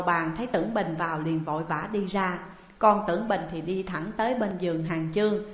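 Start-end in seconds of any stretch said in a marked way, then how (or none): none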